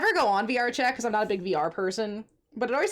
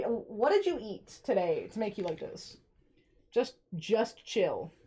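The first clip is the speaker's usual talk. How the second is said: normal talking voice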